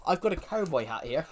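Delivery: ordinary talk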